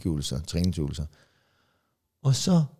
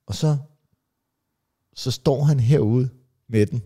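usual speaking voice

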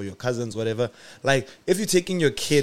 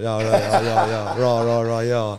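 put-on Liverpool accent; 2 semitones lower, slower than usual. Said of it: "you sound like you're from, like, Liverpool".

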